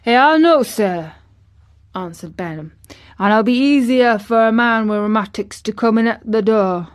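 hearty countryman's assurance; Ben Weatherstaff. A narrator's reading. Old Ben promises his young master secrecy, loudly.

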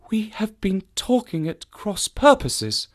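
high, slow woman's voice; vexed realisation. A narrator's reading. Woman realises she mistook the visitor, vexed.

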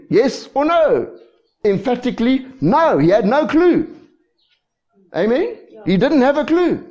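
high, loud emphatic preaching; preaching at full force. Real, not acted. Preacher to congregation, emphatic high preaching. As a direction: drive the point home to the crowd.

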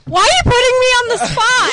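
fast, loud and high; flustered protest. Real, not acted. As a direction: blurt it out, caught off guard. Put on the spot.

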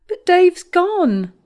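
high, slow, tearful sob; sobbing. A narrator's reading. Heartbroken girl to her sister's friend, sobbing. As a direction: let the words break into a sob. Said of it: "I sobbed".

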